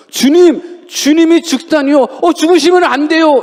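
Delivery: high pleading cry of a loving disciple; distraught disciple. Retold or imitated. Disciple pleading with Jesus not to die, high and upset. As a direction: beg him not to die, voice jumping up. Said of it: "정말로 사랑하는 제자라 그러면".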